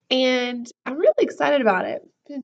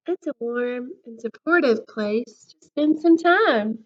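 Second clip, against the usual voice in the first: lively storybook voice, big swings of pitch; reading to children; 7 dB louder, slower than usual. A narrator's reading. Teacher reading a book to her class, lively storybook voice. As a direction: read it to the class like a picture book.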